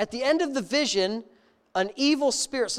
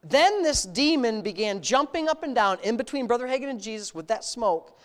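normal talking voice

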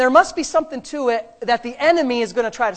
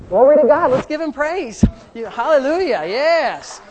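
loud, higher shout of praise; loud hallelujah to the congregation; exuberant praise; 4 semitones higher, 7 dB louder than usual. Real, not acted. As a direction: lift the room in celebration. Congregation praise after filling.

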